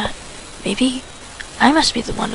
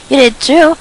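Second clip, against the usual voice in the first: loud, higher shout back; 3 semitones higher, 8 dB louder, slower than usual. Acted. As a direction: yell it at the rival. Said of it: "shouted Tristan".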